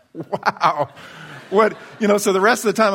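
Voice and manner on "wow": laughing wow, higher; amused disbelief; stunned laugh. Retold or imitated. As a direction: shake it off with a dazed laugh. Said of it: "I'm like".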